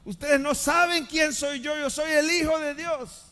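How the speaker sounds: high, loud menacing threat; threatening shout. Acted. Pastor mimics a vengeful Jesus he rejects, loud threat. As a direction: threaten them like an offended strongman. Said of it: "No gritó".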